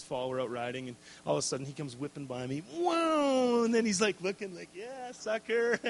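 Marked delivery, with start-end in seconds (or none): 2.8-3.6 1 startled whoa. Retold or imitated. Son whips past dad. 4.7-5.8 1 cocky taunt. Retold or imitated. Son taunts dad while passing.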